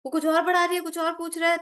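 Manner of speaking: high-pitched playful mimicry in Hindi; imitating Tanya. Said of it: "Tanya's like".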